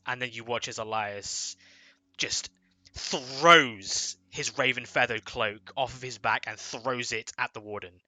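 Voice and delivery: ordinary talk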